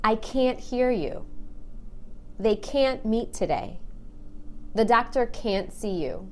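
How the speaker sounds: "can't" with a long, stressed A. Shown as a demonstration. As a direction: stretch the A in "can't" and lean on it. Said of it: "I stress that A and make it really long".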